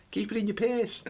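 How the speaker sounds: high, soft Scouse; a quick Liverpool twang; Scouse accent. Acted. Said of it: "That's Scouse".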